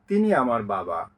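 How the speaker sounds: usual speaking voice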